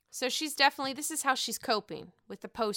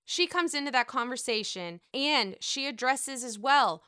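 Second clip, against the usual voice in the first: normal talking voice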